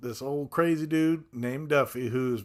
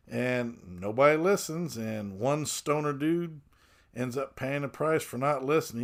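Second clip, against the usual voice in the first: usual speaking voice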